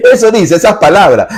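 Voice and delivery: high, loud scornful mimicry; mocking critics. Preacher mocking critics who quote a heretic priest. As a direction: sneer at what they quote.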